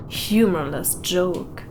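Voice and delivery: ordinary delivery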